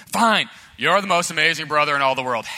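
loud, forced concession as little brother; exasperated surrender. Acted. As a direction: give in noisily under torment. Little brother gives in under torment.

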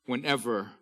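reading psalms out loud with full force, drawn out; fervent scripture reading. Acted. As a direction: proclaim each verse to the congregation.

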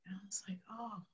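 whispered, barely audible realization; very soft, breath-only wonder; awed hush. Retold or imitated. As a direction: retell it in a tiny awestruck whisper. Child awed by father's words.

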